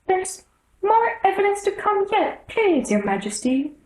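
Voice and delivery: anxious little rabbit pleading to the king. A narrator's reading. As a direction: squeak it out in a flustered hurry.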